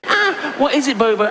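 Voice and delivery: high, laughing mock coo at a new cellmate; mock cooing inmate. Inmate coos at new prisoner.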